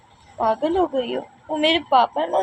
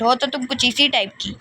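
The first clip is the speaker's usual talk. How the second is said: usual speaking voice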